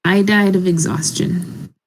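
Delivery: ordinary talk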